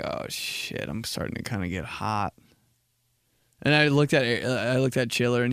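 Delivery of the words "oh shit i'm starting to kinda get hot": quiet worried. Retold or imitated. Feeling sick from candy.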